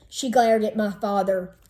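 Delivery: plain reading voice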